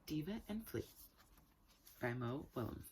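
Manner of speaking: usual speaking voice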